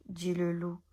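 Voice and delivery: ordinary delivery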